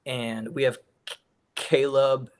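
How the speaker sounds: real stutter on the C; catching on the first sound. Real, not acted. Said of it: "I have a stutter".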